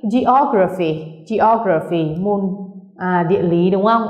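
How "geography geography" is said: geography with the O after ge stressed; stress third from end. Shown as a demonstration. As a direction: hit the second syllable in each geography. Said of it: "nhấn vào âm tiết thứ ba từ dưới lên".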